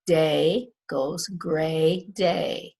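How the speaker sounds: day goes gray day said slowly, long A stretched; slow teacher modelling of the long A; slow phonics demo. Shown as a demonstration. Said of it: "That was the easy one".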